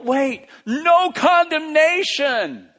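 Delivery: high, loud, drawn-out shout of discovery; exultant shout. Acted. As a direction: shout it out slowly as a sudden realization. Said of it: "And he shouts out".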